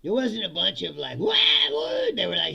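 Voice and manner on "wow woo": very high, loud imitation whoop; mock crowd whoop. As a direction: whoop like a hyped audience.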